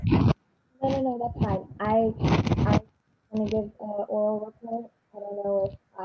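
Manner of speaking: muffled voice, bad mic. Real, not acted. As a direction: voice muffled as if through a wall. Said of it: "is my voice muffled?".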